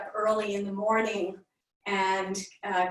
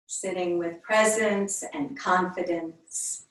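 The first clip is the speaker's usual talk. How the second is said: unhurried meditation voice with long pauses between phrases; yoga teacher leading a calm body scan; guided meditation. Real, not acted. Leads a centering meditation.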